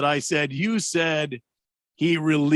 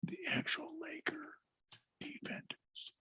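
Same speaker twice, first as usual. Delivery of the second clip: whisper; 21 dB quieter than usual. Shown as a demonstration. Trails off rereading the text.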